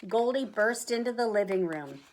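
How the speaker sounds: ordinary delivery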